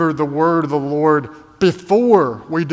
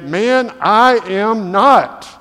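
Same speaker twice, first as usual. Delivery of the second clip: slow, drawn-out, louder flat denial; insistent denial; 5 semitones higher, 6 dB louder, much slower than usual. Acted. Peter denying Jesus by the fire, slow insistent denial. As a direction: Peter digging in his heels, each word drawn out. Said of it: "Peter insists even more decisively".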